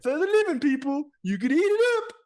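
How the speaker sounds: a cheerful vain king playing host; high king voice. King inviting guests to human food, high and cheery.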